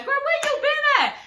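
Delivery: high put-on Angela voice; hammed-up high nosy question; mock Angela. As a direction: ask it way up high like a nosy friend. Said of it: "now Angela's".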